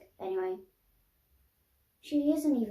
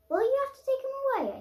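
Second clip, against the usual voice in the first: put-on pleading child; 4 dB louder than usual. A narrator's reading. Child pleads with serious man.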